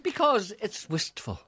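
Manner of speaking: slow, wistful high sigh. Acted. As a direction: draw it out dreamily. Wistful saxophone answer.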